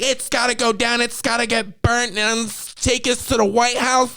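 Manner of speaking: high-pitched parody of an outraged campaigner; mock oppressed activist. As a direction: imitate her overblown crusade in a high voice. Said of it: "This girl's like".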